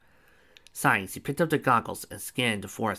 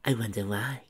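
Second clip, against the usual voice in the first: plain reading voice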